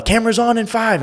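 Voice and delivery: high-pitched imitation of a crew member; imitating a floor manager's high cue; camera woman. Imitating camera woman giving orders.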